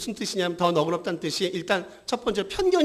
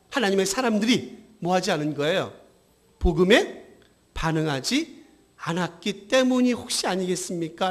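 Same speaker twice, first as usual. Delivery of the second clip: usual speaking voice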